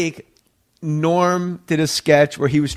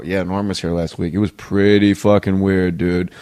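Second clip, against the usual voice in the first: lower, laid-back club regular voice, lightly voiced; comedy club guy; 9 semitones lower than usual. Club staff tell the comic about Norm, low and laid-back. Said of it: "they'd go".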